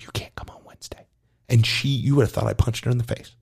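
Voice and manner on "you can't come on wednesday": quick hushed whisper; fast whispered ban to an eager gymnast. Retold or imitated. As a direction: tell her softly and fast. Telling keen gymnast she can't come.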